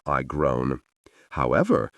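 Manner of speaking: ordinary delivery, nothing marked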